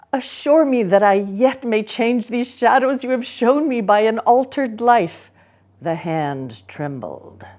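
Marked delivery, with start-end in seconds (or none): 0.1-5.3 put-on loud higher pleading old man; desperate old Scrooge. Scrooge begging the ghost of the future, desperate. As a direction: beg the phantom for a second chance.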